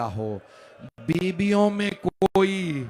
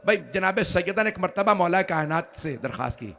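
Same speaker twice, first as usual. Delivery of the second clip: normal talking voice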